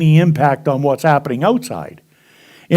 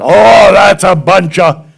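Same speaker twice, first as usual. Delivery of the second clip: scoffing student; 3 semitones higher, 9 dB louder, slower than usual. Retold or imitated. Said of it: "a young man in the front".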